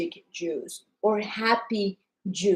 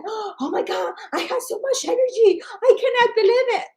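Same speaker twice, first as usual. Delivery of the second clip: much higher and rising, thrilled; imitating excited customer; 12 semitones higher, 3 dB louder than usual. Customer raving about her new energy, in high excited voice. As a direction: gush like you can't believe it. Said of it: "And she said".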